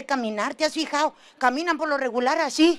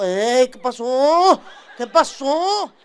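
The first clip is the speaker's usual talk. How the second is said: laid-back dad; 6 dB louder, slower than usual. Retold or imitated. Papá llega despreocupado.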